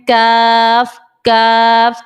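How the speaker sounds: کاف with a light k and long thin vowel; کاف drawn out, light and fine; thin kaf. Shown as a demonstration. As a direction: say کاف thin, tongue a little further forward. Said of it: "اور اگر ہم کاف پڑھتے ہیں".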